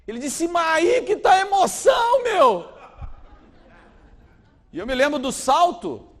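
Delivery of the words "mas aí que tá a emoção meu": loud, high, thrilled laugh-yell; laughing shout. Retold or imitated. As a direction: revel in the danger. Young man brags about thrill.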